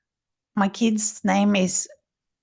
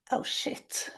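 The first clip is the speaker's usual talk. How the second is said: whispered curse; 4 semitones higher, 9 dB quieter than usual. Retold or imitated. Realizing the burn.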